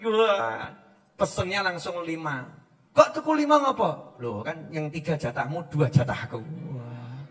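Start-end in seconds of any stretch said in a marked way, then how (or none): 2.9-4.2 high, puzzled challenge; questioning wife. Acted. Wife asks why husband bought five, high and puzzled. 4.2-6.4 low, soft explanation; sweet husband. Acted. Husband shares the food order with wife, softly.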